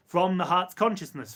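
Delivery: ordinary talk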